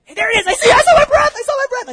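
put-on a boy thrilled to see his breath; playful imitation of a giddy child; excited kid.

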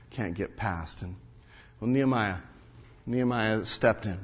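normal talking voice

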